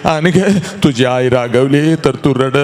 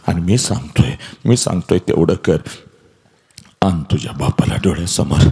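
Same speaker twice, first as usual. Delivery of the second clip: guided visualization address; 6 semitones lower than usual. Acted. Motivational speaker guiding schoolgirls to picture their father, in a low hushed voice. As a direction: speak low and intimate, walking them through the memory.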